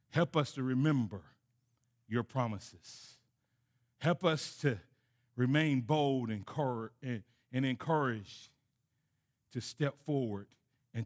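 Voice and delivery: soft, hushed pastor's prayer; closing prayer. Acted. Pastor prays for the church, soft and hushed. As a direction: pray it quietly over the congregation.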